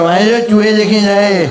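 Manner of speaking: loud, slow flare of temper; flaring up. Retold or imitated. Fed-up uncle in the rickshaw snaps at the boys, loud and slow. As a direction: bark it out, losing his temper. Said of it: "अबा का दर भड़क गया".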